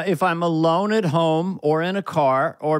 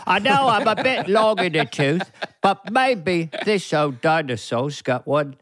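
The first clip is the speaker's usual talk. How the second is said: Cockney Caine voice, a bit long in the tooth; Michael Caine. Pretend. Prank call to J J Abrams as Michael Caine. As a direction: pitch yourself to the producer as old Caine. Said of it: "This is Michael Caine here".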